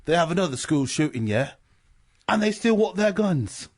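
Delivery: imitated British accent. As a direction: play an incredulous Brit commenting. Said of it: "the British are like".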